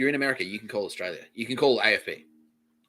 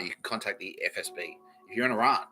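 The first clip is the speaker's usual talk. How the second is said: normal talking voice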